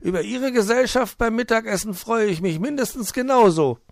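high, loud, jolly fox voice; high-pitched welcoming fox; cheerful fox. Acted. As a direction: play the hospitable old fox, beaming. Fox voice held.

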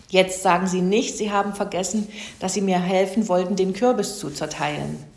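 usual speaking voice

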